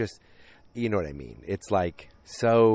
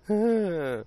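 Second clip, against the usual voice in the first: laugh; 13 semitones higher than usual. Real, not acted. Laugh after Betty's line.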